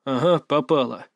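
understated grumble under the narration; a touch of a grunted reply; grumbling. A narrator's reading. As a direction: grumble it back, only mildly. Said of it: "буркнул я".

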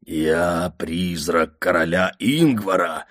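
slow, sepulchral ghost voice; ghostly voice. A narrator's reading. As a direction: speak from beyond the grave. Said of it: "загробным голосом сообщил я".

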